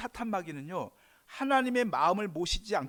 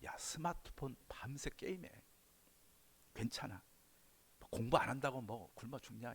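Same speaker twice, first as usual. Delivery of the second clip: soft, lower coaxing devil voice; a tempter murmuring to a child, softer; devil whispering; 4 semitones lower, 6 dB quieter than usual. Acted. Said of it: "다가와 속삭입니다".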